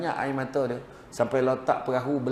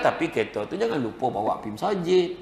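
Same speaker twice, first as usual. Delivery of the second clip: ordinary talk, nothing marked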